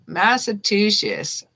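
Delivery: "Massachusetts." spelled out slowly, syllable by syllable; slow down through "Massachusetts.". Shown as a demonstration. Reader proving she can say the hard word, slow. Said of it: "I have to say it slowly".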